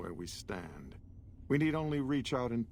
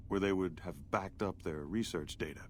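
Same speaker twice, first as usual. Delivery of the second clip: ordinary delivery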